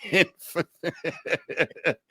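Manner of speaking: laughed out slowly; laughing. Real, not acted. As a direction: laugh through the words. Laughing at himself.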